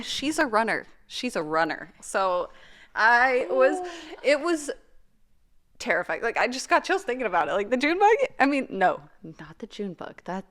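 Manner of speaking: usual speaking voice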